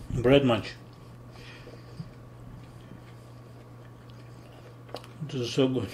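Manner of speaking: chewing while talking; talking between bites; mouth full. Real, not acted. Seen 1: Holds bitten burger at mouth, chewing until 2.6; then stands empty-mouthed.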